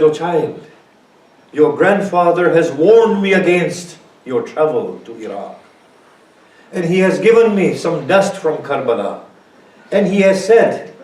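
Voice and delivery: high, loud pleading grandmother; impassioned pleading. Acted. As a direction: beg him not to go, full preaching force. Grandmother's plea, preached recital.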